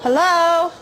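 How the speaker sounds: loud call into the dark woods; nervous loud hello into the woods. Real, not acted. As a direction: call out loud to whoever is there. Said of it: "Sorry for screaming".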